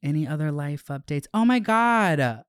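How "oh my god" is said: excited gasp. Real, not acted. Excited about switching topic.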